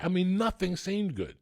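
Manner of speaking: ordinary delivery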